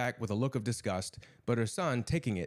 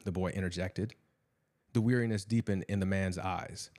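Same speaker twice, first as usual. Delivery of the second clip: ordinary delivery, nothing marked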